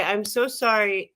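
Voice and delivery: usual speaking voice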